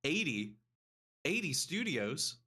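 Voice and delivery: high excited surprise. Retold or imitated. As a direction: gasp at the news with glee. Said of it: "I was like".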